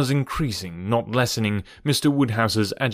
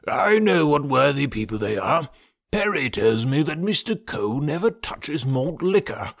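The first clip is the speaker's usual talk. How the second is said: conceding Mister Woodhouse. Acted. Father persuaded not to hurt the Coles, earnestly fussy. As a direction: concede anxiously, lingering on the odd phrase.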